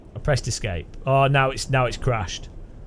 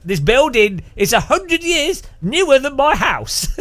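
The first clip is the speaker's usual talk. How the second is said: raised, high-pitched British objection; indignant protest; 8 semitones higher, 4 dB louder than usual. Retold or imitated. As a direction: protest in mock disbelief, voice pitched up. British visitor protesting to tour guide.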